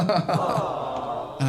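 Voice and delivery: laughing. Real, not acted. Dad moved by son's kindness.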